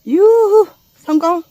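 high, loud whoop then a shouted "성공!"; excited cheer. Real, not acted. Hiker cheers reaching the peak, loud whoop. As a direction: whoop for joy at the top.

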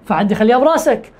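ordinary talk, nothing marked

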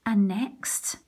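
usual speaking voice

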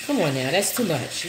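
ordinary delivery

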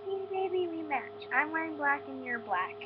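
high cooing voice, gliding down. Real, not acted. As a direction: coo to a beloved bunny. Cooing to her pet bunny.

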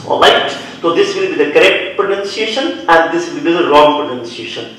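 ordinary talk, nothing marked

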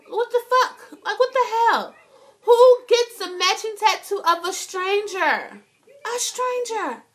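high-pitched, incredulous outburst; exasperated disbelief. Real, not acted. Vlogger ranting about a stranger tattoo, high-pitched disbelief.

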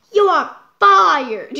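loud boss shout; loud furious boss bellow; boss yelling. Acted. Boss fires Miss Muzzle.

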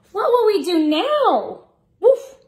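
high, lively storybook question and bark; reading to children. Acted. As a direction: ask it brightly for little listeners.

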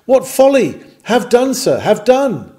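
ordinary talk, nothing marked

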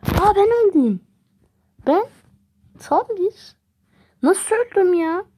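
high, loud disbelieving yell; real shocked outcry; outraged shout. Real, not acted. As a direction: scream at the sudden death. Player suddenly dies.

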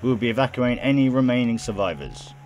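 quick, projected broadcast voice; radio announcer. Acted. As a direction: rattle out an emergency announcement. Reads radio broadcast in game.

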